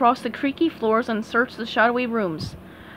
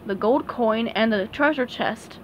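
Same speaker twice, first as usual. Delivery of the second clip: ordinary delivery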